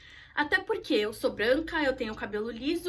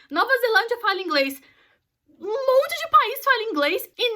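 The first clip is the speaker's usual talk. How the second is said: put-on louder, spelling it out like to a child; exasperated lecture; 3 semitones higher, 6 dB louder than usual. She lectures a foreigner on colonization, fed up and louder. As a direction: patiently explain the obvious, annoyed.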